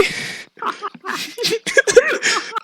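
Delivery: high, breathy burst of laughter; big laugh. Real, not acted. Cracking up while retelling the plane scare. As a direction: crack up mid-story. Telling of a priest-like passenger.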